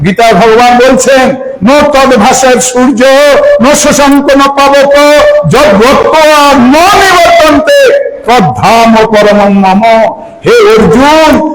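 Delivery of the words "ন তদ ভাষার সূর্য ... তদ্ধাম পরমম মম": slow chanted Sanskrit verse; Sanskrit recitation. Acted. Preacher recites Bhagavan's words from the Gita, slow chant.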